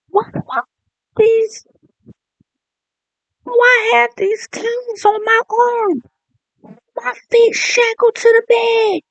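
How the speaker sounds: high, loud panicked mock screech; mock screaming. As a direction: shriek in panic, strapped to the bed. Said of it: "you're gonna be screaming".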